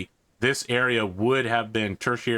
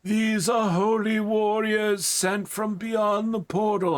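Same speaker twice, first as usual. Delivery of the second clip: put-on old priest, much higher, slow and solemn; 12 semitones higher, slower than usual. Priest introducing holy warriors to guards, high solemn voice. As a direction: proclaim it like a pompous old cleric. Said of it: "he's like".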